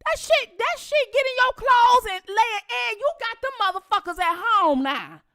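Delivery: high loud indignant comeback; arguing with auntie over roach; heated retort. Retold or imitated. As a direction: snap back at auntie.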